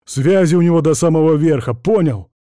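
loud, quick boasting; puffed-up, forceful boast; proud. A narrator's reading. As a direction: brag about connections to put him down. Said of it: "гордо произнёс Трегубов".

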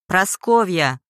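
loud shouted name; shouting. A narrator's reading. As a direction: yell for her to show herself. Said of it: "снова закричал Алексей". (громкий крик)

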